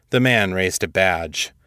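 usual speaking voice

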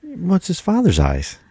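high, slow mock-dramatic quote; hammed-up film line. Co-host quotes the answer about the baby's eyes, hammed-up. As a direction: draw it out in a put-on high voice.

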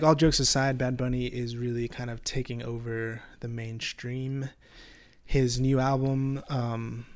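usual speaking voice

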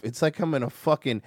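ordinary talk

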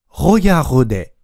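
ホヤホデ with breathy Japanese h sounds for each French r; learner's mispronunciation. Shown as a demonstration. Teacher showing how learners botch regarder, mispronounced as ホヤホデ. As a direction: mimic a struggling learner's ホヤホデ. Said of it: "となってしまう学習者泣かせ".

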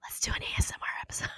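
quick playful whisper; ASMR whisper. Pretend.

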